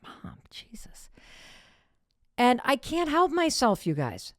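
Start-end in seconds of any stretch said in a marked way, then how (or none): 0.0-1.1 slow embarrassed whisper. Retold or imitated. Teen son hissing at his pushy mother, whispered slowly. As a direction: mutter it slowly under your breath. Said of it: "he's like".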